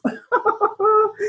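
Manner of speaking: sudden high-pitched squeal of a laugh; high squeaky laugh. Real, not acted. Laughing at own joke about August off, high squeal. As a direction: let a squeaky laugh burst out. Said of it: "What was that?".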